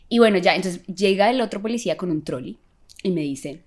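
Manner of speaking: ordinary talk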